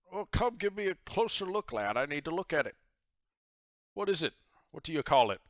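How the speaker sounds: high, curious pirate captain; pirate god voice. Acted. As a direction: lean in like an eager old sea dog.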